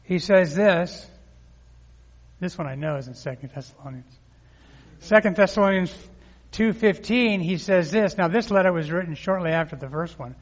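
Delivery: emphatic pulpit reading; preaching. Real, not acted. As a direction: announce the verse with weight. Announcing the next verse.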